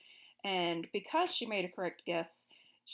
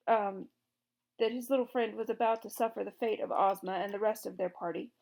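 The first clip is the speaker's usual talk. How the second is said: usual speaking voice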